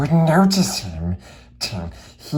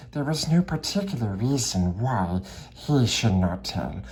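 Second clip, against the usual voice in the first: usual speaking voice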